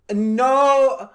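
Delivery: high, loud, drawn-out words; mock tourist yell. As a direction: imitate a tourist struggling loudly and slowly. Retold tourist shouting at waiter.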